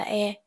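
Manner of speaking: "E" said as a short open eh; sound of E. Shown as a demonstration. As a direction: demonstrate "E" as eh, not ee. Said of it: "E được phát âm là".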